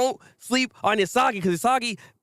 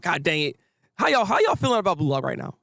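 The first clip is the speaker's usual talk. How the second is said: normal talking voice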